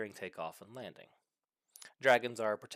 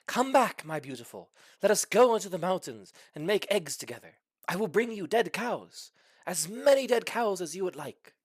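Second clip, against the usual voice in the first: loud, high pleading dragon call; 6 semitones higher, 7 dB louder than usual. Acted. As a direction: call after her across the sky, begging. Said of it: "calling out to her in Dragonish".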